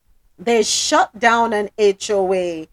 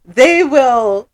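loud; 9 semitones higher, 7 dB louder than usual. Real, not acted. Warning about liens.

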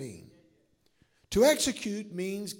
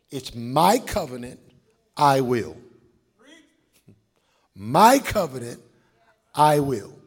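emphatic declaration; 5 semitones lower, 9 dB louder than usual. Acted. Preacher declaring God's words.

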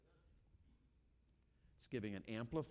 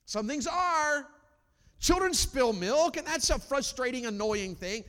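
loud, high, drawn-out preacher's cry; stretched, ringing sermon emphasis; full-force preaching; 13 dB louder, slower than usual. Real, not acted. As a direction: preach it slowly at full volume.

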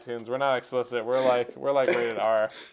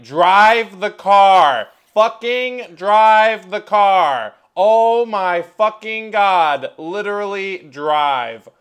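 loud, slow, high yelling at drivers; furious shout; 7 semitones higher, 13 dB louder, much slower than usual. Retold or imitated. Frustrated driver screaming at stopped cars at a green light. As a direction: bellow it at the stalled traffic. Road rage at stopped traffic.

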